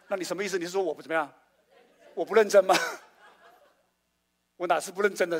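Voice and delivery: hammed-up outrage with a laugh; mock indignant. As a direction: play the wounded pastor, laughing. Pastor mock-offended, laughing.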